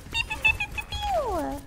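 little high-pitched play gunfire; playful gun noises. Pretend. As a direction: squeak out toy laser shots. Imitating gunfire during the game.

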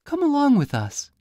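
put-on very high, bright girl's voice; the daughter invites Puss, very high; farmer's daughter.

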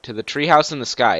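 ordinary delivery

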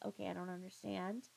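normal talking voice

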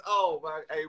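usual speaking voice

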